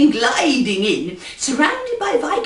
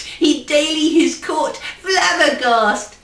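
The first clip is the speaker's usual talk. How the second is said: usual speaking voice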